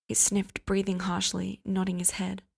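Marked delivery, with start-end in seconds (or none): none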